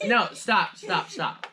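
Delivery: usual speaking voice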